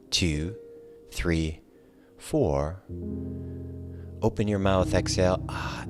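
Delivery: ordinary talk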